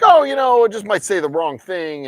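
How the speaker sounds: high, loud mock-innocent excuse. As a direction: put on a high whiny innocence.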